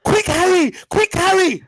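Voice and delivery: high urgent cry; urgent calling. Acted. Player calls Harry to come quick, urgent high calling. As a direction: call out in a high, pressing voice.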